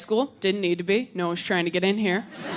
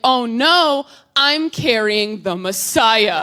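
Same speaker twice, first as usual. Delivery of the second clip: loud, slowing comic alarm; mock dread; 5 dB louder, much slower than usual. Abstinent teen missing her period, loud mock dread. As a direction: declare it as a grand catastrophe.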